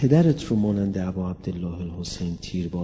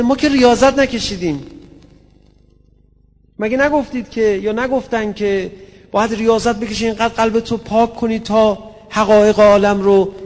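much higher, much louder impassioned preaching; preaching at full force; 13 semitones higher, 12 dB louder than usual. Acted. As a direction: cry out the questions to the crowd. Preacher voices a questioner at full force. (خطابهٔ پرشور با فریاد)

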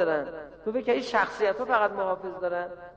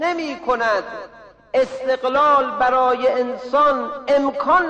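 raised, measured sermon proclamation; emphatic preaching; 4 semitones higher, 3 dB louder than usual. Real, not acted. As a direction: proclaim it firmly to the congregation. Preacher proclaims the main lesson. (موعظهٔ پرشور و قاطع)

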